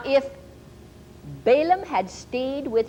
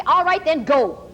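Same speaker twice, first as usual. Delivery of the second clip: exasperated; 6 semitones higher, 5 dB louder than usual. Retold or imitated. Worn-out mom gives in.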